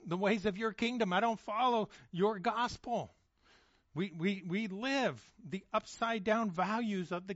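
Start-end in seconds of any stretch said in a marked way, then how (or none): 0.1-3.1 raised, pleading confession to God; heartfelt prayer. Retold or imitated. Preacher voicing a congregation's confession to God, earnest and raised. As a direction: pour it out, earnest and lifted. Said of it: "We say".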